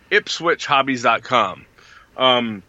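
ordinary talk

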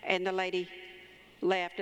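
ordinary talk

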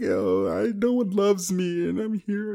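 high-pitched exaggerated weepy whine; mock crying. Imitating own crying over life decisions, mock crying. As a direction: wail it like a hammed-up self-pitying cry. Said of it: "that's how I sound when I cry".

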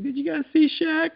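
mock high-pitched waitress asking; waitress impression. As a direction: squeak it out like an eager waitress. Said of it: "And she's like".

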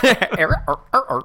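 high, loud mock turntable scratch noises; record scratch. Ouija board turned turntable, mock scratch sounds. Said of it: "It turns into a turntable".

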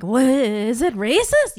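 hammed-up wary double take; mock suspicion. Co-host acting out the constant question in their head, mock suspicion. Acts out own habitual suspicion.

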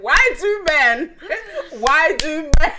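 loud, high, drawn-out lament; hammed-up despairing cry; mock exasperation. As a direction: wail it slowly through laughter. Exasperated reaction to the man's audacity.

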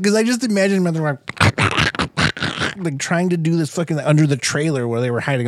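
ordinary delivery

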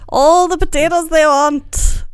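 loud, high, slow Irish brogue; Irish accent. Pretend. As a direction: stretch it out in an Irish lilt. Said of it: "Still not great".

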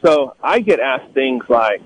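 ordinary delivery

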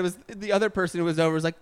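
ordinary talk

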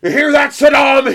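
high, loud taunting shout; hammed-up loud challenge; mock wrestler call-out. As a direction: call out a rival in a wrestling promo. Continues the wrestler parody.

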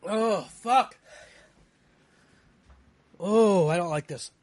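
loud pained moaning; real suffering. Real, not acted. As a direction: moan and curse through the burn. Still suffering from the burn.

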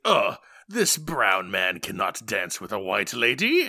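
slow, haughty British snob; drawn-out mock snooty disdain; snooty British guy. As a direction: play a disgusted, stuck-up Englishman. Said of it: "snooty British guy is like".

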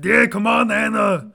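high, loud, put-on character voice; noisy mock superhero voice; The Thing. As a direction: goad them in a hammed-up cartoon shout. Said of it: "Be like me. The Thing".

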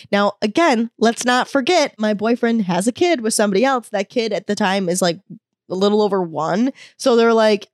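ordinary delivery

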